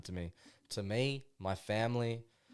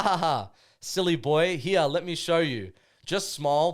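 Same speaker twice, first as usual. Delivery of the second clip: a hammed-up cocky brother, louder and laughing; mocking head voices; 4 semitones higher, 6 dB louder than usual. Voicing the cocky voices in his head as they egg him on, hammed-up. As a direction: goad him like a swaggering mate. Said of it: "So now I'm going into, like, their voice".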